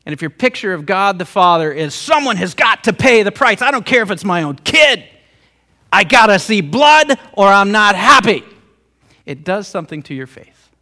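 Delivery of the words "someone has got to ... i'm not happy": loud, hammered-out angry ultimatum; wrathful demand. Acted. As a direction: thunder it like a vengeful father. Voicing a wrathful God demanding payment.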